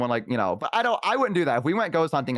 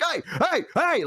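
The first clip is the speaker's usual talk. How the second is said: high, short mock yelps; startled yelps; 13 semitones higher than usual. Roommate reacting to a bark at the door, high yelps. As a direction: yelp back at the barking. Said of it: "like, bark, and he'd be like".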